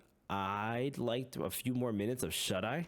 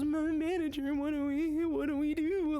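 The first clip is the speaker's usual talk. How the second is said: much higher and louder, stammering timid voice; scared Sinclair impression; 4 dB louder than usual. Streamer showing how he hears Sinclair, high scared stammer. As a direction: do Sinclair as a trembling kid. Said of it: "This is genuinely how I just hear Sinclair".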